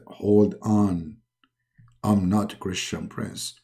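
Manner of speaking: low, slow divine voice; low and deliberate like God; Allah voice. Acted. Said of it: "he call him".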